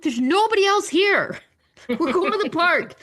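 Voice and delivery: retold arguing with the attendant; exasperated pleading. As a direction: push up high, fed up.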